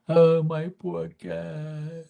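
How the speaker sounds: high, drawn-out mock lament; hammed-up wailing impression of mother; dramatic mom. Mom mourning her dead cat, mock dramatic. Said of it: "She's, like, all dramatic".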